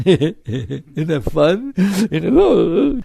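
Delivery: drawn-out chuckle breaking into the speech; laughing. Acted. Teacher mocking the fun of rising anger, laughing. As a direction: laugh through the words, voice jumping up.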